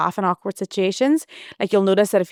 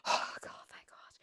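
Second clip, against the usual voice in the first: breathless whispered relief; relieved whisper; 19 dB quieter than usual. Retold or imitated. Relief as he kneels.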